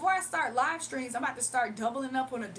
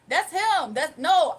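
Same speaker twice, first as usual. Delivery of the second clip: loud, insistent exclaim; emphatic shout; 2 semitones higher, 9 dB louder, slower than usual. Real, not acted. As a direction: burst out insisting. Excited insistence.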